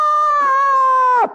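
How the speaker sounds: long, high mock scream; falling scream. Priest acting out a fall from the skyscraper, long high scream. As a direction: scream all the way down.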